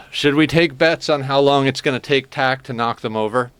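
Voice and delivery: normal talking voice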